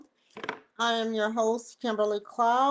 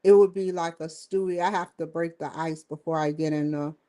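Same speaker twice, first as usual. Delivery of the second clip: ordinary delivery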